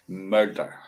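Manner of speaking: ordinary talk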